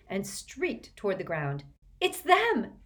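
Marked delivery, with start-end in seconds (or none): 2.0-2.8 high, loud excited cry; scream. A narrator's reading. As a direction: scream it out as you spot them. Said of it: "screamed Hermione".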